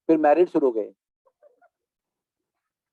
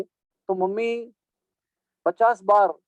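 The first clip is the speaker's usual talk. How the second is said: ordinary talk, nothing marked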